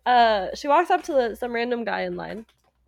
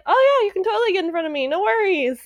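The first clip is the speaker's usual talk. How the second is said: much higher, louder, fast eager yes; bribed eager yes; 10 semitones higher, 5 dB louder, faster than usual. Retold or imitated. Guy in line after getting a check, eagerly gushing. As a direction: gush as if bought off. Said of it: "he's like".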